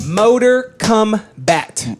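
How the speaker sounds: MOTOR KOMBAT drawn out very slowly, word by word; slow, deliberate repeat. Pretend. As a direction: stretch out "Motor Kombat" for effect. Repeats title slowly for effect.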